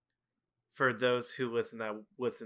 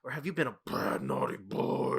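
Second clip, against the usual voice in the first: dark creepy caller. Retold or imitated. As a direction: imitate the Fiend's dark voice. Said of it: "then it gets super dark".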